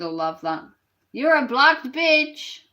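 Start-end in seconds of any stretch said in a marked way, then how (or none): 1.1-2.6 slow, loud, sing-song jeer; mocking taunt. Real, not acted. Streamer jeering at a viewer just blocked, slow gloating taunt. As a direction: drag it out to gloat.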